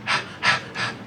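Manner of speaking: soft, breathy dog pants; dog panting. Retold or imitated. Comic telling how the dog went, soft panting. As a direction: pant quietly like a dog. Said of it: "You know, like dogs do".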